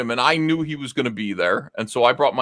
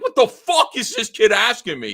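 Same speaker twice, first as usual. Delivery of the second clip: higher, outraged mock rant; imitating a fuming old man's inner voice; mock fury; furious disbelief; 11 semitones higher than usual. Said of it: "furious with me".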